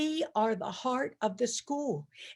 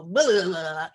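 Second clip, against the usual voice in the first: mock garbled chorus; 4 semitones lower, 5 dB louder than usual. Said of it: "we'll go".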